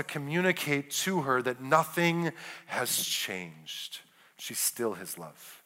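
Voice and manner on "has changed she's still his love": gentle, hushed close of a point; hushed emphasis. Real, not acted. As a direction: drop very low and soft. Softly underlines the husband's unchanged love.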